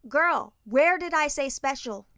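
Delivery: high, loud, slow exasperation; frustrated. Retold or imitated. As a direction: challenge the child in mock disbelief. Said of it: "she can see I'm frustrated".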